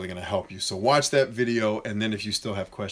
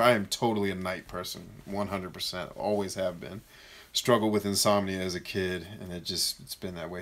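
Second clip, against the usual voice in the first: usual speaking voice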